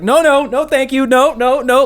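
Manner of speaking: high, hurried-sounding string of refusals, imitated; mock panicked refusal. Host acting Bob escaping a circling winner, mock panic. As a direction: wave off a pursuer in hammed-up alarm. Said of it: "trying to get him".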